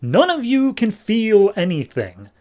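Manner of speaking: high, exasperated mock outburst; snapping. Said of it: "Will eventually snaps".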